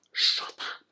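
voiceless mock hushing; whispered shut up. Host stopping her own immigrant joke, whispered. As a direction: cut yourself off in a whisper.

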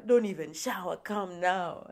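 loud, urgent mock order; insistent friend. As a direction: cut in loudly, allowing no argument.

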